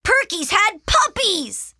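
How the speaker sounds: high, loud, drawn-out delight; overjoyed child's exclamation; thrilled announcement. Acted. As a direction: break the wonderful news slowly. Arthur announces surprise puppies.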